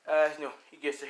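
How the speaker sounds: usual speaking voice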